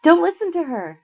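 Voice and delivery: loud, high desperate shout. A narrator's reading. Annabeth warning Percy from hiding, shouting loudly. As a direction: yell the warning across the garden. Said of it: "Annabeth's voice shouted".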